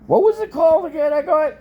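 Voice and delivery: very high, loud call to another room. Real, not acted. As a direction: shout the question across the house. Calling out for the dish name.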